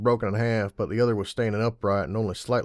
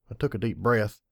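usual speaking voice

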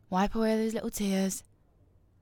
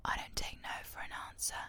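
fast, threatening whisper; menacing whisper; 15 dB quieter, much faster than usual. Acted. Bully whispering a threat to her captive. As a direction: hiss the threat close to the ear.

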